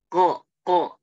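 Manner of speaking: ko with no breath released; unaspirated qaf. Shown as a demonstration. Teacher showing breathless ko. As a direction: hold the breath back on ko. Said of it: "nggak ada keluar nafas dia".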